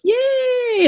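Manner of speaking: high, drawn-out little cheer; imitated little-boy glee, stretched high; little gleeful voice. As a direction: squeal it in a tiny high voice. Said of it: "in that little voice".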